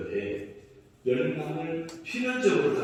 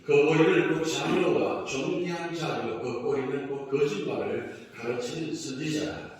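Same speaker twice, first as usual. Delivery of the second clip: loud, chanted recitation of scripture; unison scripture reading; 3 semitones higher, 7 dB louder than usual. Acted. Pastor leading the congregation in responsive reading, loud chant. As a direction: read it out together like a congregation.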